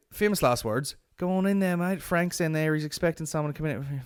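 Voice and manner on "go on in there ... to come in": higher, breezy bloke voice; breezy staff guy waving him in; mimicking the staff guy. Staff guy waving comedian into the room, breezy and higher. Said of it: "the way he's like".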